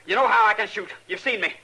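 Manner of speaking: loud, high threat; threatening. Acted. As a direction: warn him you're a crack shot. Gunman threatening the marshal.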